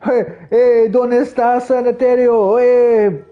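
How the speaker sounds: high, loud hammed-up tourist Spanish; mock gringo Spanish. Clueless American asking for the bathroom, high and loud. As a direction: call out like a lost American tourist.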